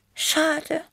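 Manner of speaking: very high little cat voice, sighing; sighing Tippi. A narrator's reading. Small cat regrets the music stopped, sighing. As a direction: sigh it in a tiny high voice. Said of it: "seufzte Tippi".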